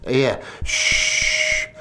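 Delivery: unvoiced shh of air rushing out; breathy mimicked hiss of a mask. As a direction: imitate the hiss of the oxygen mask. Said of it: "and I hear that".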